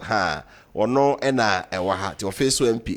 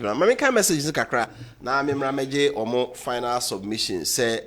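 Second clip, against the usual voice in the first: usual speaking voice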